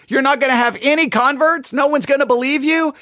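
ordinary delivery

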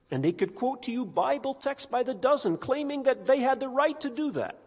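high, loud impassioned preaching; preaching at full force. Acted. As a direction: preach it with rising fire.

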